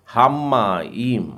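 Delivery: हामाईम slowly, with म held double; हामाईम with a long म; doubled m. Shown as a demonstration. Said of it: "म का उच्चारण भी दो बार करना है". (हामाईम, म दो बार)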